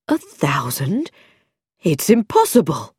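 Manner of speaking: stunned vicar, drawing out the first words; disbelief. A narrator's reading. Vicar hears of his son's salary, stunned disbelief. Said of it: "his father's disbelief".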